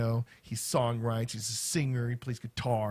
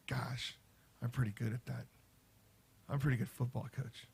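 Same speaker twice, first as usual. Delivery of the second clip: hushed aside to himself; 7 dB quieter than usual. Retold or imitated. Private musing quoted to himself.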